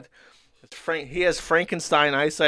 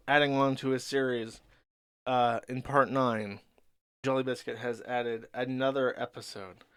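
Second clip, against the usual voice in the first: normal talking voice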